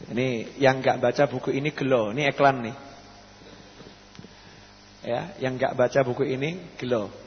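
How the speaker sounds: low, soft offhand voice; casual aside. Real, not acted. Preacher asking a helper to fetch his new book, casual aside.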